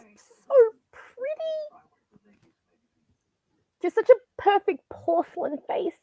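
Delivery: high, breath-only swoon of admiration; gushing whisper. Real, not acted. Podcaster gushing over Winona's looks, awed whisper. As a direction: whisper it slowly, in awe of her looks.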